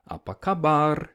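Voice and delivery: Apa kabar with a trilled final R; rolled r. Shown as a demonstration. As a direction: Apa kabar, rolling the R at the end. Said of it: "get your mouth into that position".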